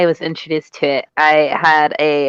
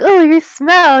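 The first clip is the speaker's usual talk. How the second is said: mock Marion; 12 semitones higher, 5 dB louder, slower than usual. Marion voiced in retold scene.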